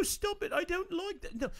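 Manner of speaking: squeaky falsetto protest; high whiny girl voice. Retold or imitated. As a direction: whine it high like a prissy girl. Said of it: "my impression again".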